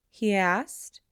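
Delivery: ordinary delivery, nothing marked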